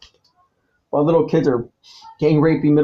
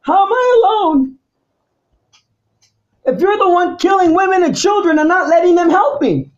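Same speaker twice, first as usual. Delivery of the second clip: furious rant; 9 semitones higher than usual. Real, not acted.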